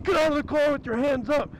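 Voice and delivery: imitating cops ordering her out of the car; cop's command. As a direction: bark it like a cop at a felony stop. Cops doing a felony stop.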